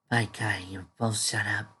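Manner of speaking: plain reading voice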